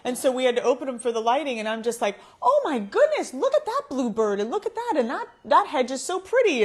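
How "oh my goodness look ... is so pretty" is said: high, loud burst of wonder; gushing delight. Retold or imitated. Gushing over a bluebird out the window, delighted exclaiming. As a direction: exclaim it in high-pitched awe. Retelling delight at backyard birds.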